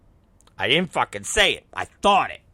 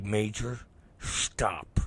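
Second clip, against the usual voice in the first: low, hushed command; quiet stern warning; 5 semitones lower, 9 dB quieter, much slower than usual. Retold or imitated. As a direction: warn the kid under your breath.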